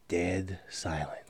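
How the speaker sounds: very low, very soft and slow; hushed deadpan. A narrator's reading. Storyteller describes the family after the disaster, hushed deadpan. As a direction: drop to a grave near-murmur.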